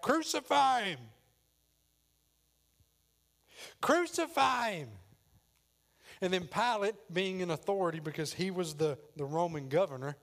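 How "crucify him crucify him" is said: loud, high drawn-out mob shout; crowd shouting. Acted. Crowd shouting back at Pilate, loud shout. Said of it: "they shouted back".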